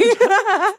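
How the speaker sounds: sudden squealing laugh, loud and high; high burst of laughter. Real, not acted. Host laughs at forgetting a name, loud high laugh. Laughing at not knowing names.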